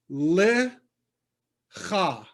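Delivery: "le cha" in two separated syllables; teacher sounding out "le ... cha"; syllable-by-syllable demo. Shown as a demonstration. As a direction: sound out "le", pause, then a throaty "cha". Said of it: "say it to yourself. All right, the answer is".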